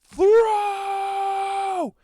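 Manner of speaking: loud high scream; screamed command. A narrator's reading. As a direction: scream the order to the ogres. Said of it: "he just screams".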